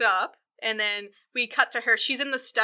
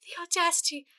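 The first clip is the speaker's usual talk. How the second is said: lady rehearsing her lines; 7 semitones higher, 4 dB quieter than usual. Retold or imitated. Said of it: "She's like".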